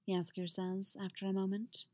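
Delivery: ordinary delivery, nothing marked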